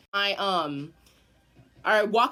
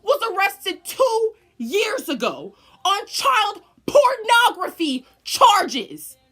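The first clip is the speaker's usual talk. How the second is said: higher, louder, slow furious telling; outraged rant; 10 semitones higher, 7 dB louder, slower than usual. Real, not acted. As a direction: stretch out the outrage. Outraged storyteller ranting.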